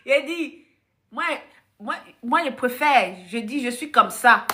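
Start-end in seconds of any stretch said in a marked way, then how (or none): none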